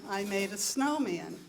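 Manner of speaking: ordinary talk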